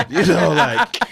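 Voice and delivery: high laugh into words; fond laugh. Real, not acted. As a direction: laugh warmly at the memory. Laughs recalling brother's wish.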